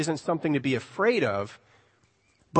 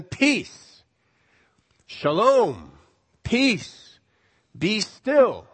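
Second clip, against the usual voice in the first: a lightly voiced commanding Jesus, raised pitch; Jesus calming the storm; 5 semitones higher, 3 dB louder, much slower than usual. A narrator's reading. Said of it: "And he says to them".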